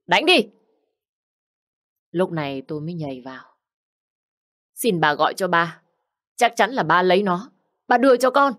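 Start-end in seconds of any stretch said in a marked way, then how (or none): 0.1-0.5 high, loud barked command; shouted order. Acted. Mistress to servants, ordering the beating, loud shout. 4.7-8.5 rising desperate plea. Acted. Girl begging the mistress to call her father, rising plea.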